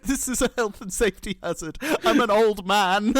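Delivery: high, loud comic complaint; mock outraged old king. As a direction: protest in a put-on indignant voice. Acts the king's outraged protest.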